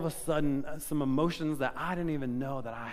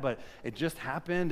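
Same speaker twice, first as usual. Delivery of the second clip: usual speaking voice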